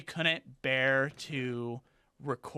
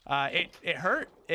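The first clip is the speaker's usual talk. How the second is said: normal talking voice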